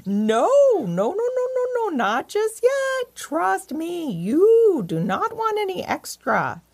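high, swooping cow voice; urgent, high-pitched cow warning; mooing cow. A narrator's reading. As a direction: warn them off with long, mooing vowels. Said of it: "Taylor mooed and said".

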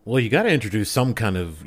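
ordinary talk

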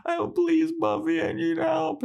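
very high, squeaky begging; hammed-up falsetto pleading, words running on desperately; mock groveling. Willow groveling to Buffy for help, squeaky mock begging. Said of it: "Willow just starts, like, groveling".

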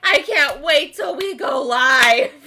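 loud, drawn-out hyped-up cheer; enthusiastic quote. Retold or imitated. Retelling the keen boy waiting to go live, enthusiastic. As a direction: shout it slowly like you're bursting to start. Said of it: "clapping enthusiastically".

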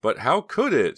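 shocked. A narrator's reading. Shocked question after a fall.

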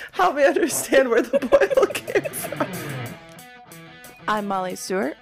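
usual speaking voice

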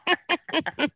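shorter high villain cackle; a quick wicked cackle; evil laugh. Pretend. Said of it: "Everybody evil laugh".

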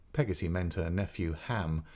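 ordinary delivery, nothing marked

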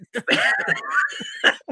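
high shrieking laugh; real cackling shriek. Real, not acted. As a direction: burst out laughing. Laughs at own joke.

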